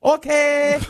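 very high mock Chinese-accented echo; high-pitched, hammed-up Chinese accent. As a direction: parrot the lady in an exaggerated Chinese accent. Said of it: "in a mocking accent way".

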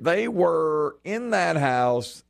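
ordinary talk

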